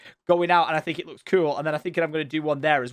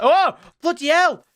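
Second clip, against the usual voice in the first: startled curse; 10 semitones higher, 6 dB louder than usual. Real, not acted. Startled by a mob.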